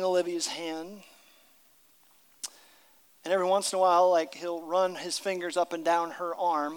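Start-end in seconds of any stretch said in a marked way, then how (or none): none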